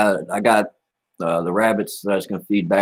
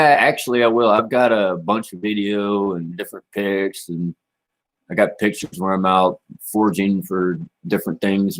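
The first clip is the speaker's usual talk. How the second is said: ordinary talk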